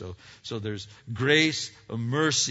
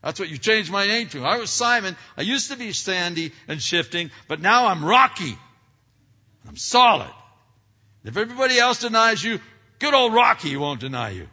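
high, loud, fast cocky Peter voice; brash, boastful disciple, much higher and louder; Peter boasting; 9 semitones higher, 13 dB louder than usual. Retold or imitated. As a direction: play Peter puffing himself up as the rock. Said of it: "Peter chimed in and said".

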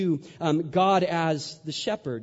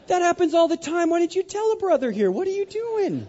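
high mock-outraged repeat of the words; incredulous squeal; 13 semitones higher, 4 dB louder than usual. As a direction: squeak it out in disbelief, much higher. Incredulous mock repeat of camp worker.

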